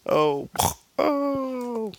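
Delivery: high, loud drawn-out oohing; crowd-style reaction to a savage comeback; mock shock. Narrator reacts to Batman's cold retort, mock shock.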